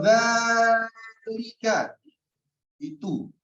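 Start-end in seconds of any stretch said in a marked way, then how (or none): 0.0-1.8 slow, stretched zalika; drawn-out recitation. Shown as a demonstration. Teacher stretching zalika to show its length. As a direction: hold the long vowel on zalika. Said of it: "Ini menunjukkan yang jauh".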